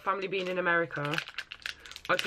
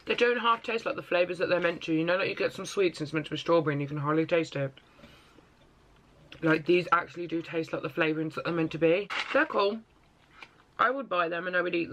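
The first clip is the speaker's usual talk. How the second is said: talking with food in mouth; chewing candy while talking. Real, not acted. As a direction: mouth partly full of sweets. Seen 1: Candy put in mouth just before; jaw moving, wrapper in hand.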